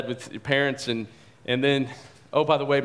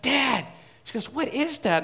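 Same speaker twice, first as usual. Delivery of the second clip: high frightened cry, much higher pitch; frightened yell; 11 semitones higher than usual. Retold or imitated. Daughter hearing a noise at dawn, a high frightened yell. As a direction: daughter alarmed at a noise, crying out. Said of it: "she starts yelling".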